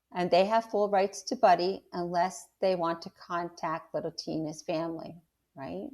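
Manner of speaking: ordinary delivery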